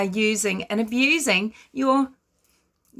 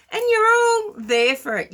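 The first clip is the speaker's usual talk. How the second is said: exasperated outburst; 11 semitones higher, 4 dB louder than usual. Real, not acted. Ranting about gullible viewers.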